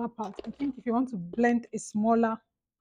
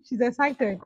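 normal talking voice

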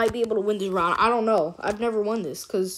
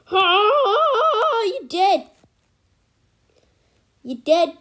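gloating cry; 9 semitones higher, 4 dB louder, much slower than usual. Real, not acted. Taunting a defeated enemy.

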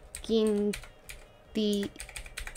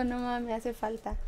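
ordinary delivery

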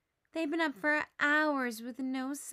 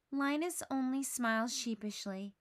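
ordinary delivery, nothing marked

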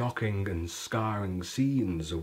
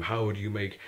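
ordinary talk

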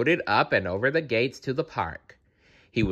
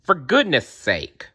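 exasperated grumpy bear, pitched up; grumbling; 6 semitones higher than usual. A narrator's reading. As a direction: grumble with impatience. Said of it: "grumbled Grumpy Bear".

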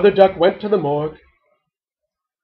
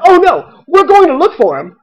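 loud, high duckling voice; protesting kids; 11 semitones higher, 7 dB louder than usual. A narrator's reading. Ducklings reassuring their mother, high-pitched protest. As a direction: pipe up in high, eager protest.

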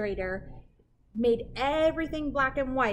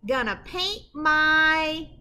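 slow, drawn-out build to the rhyme; suspense pause; 5 semitones higher, 7 dB louder, much slower than usual. Acted. Reading to kids and pausing before the rhyme, drawn-out suspense. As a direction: leave them hanging before the rhyme.

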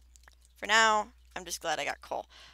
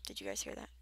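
hushed; 11 semitones lower, 11 dB quieter, faster than usual. Real, not acted. Hears a cave sound.

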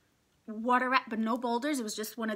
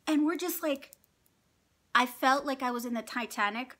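ordinary talk

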